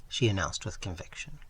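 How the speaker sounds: usual speaking voice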